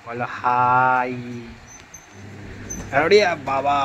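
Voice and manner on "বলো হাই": very slow, drawn-out prompt, a bit lower; coaxing the pet to say hi to viewers, slow. Real, not acted. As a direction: stretch it out like coaxing a little one.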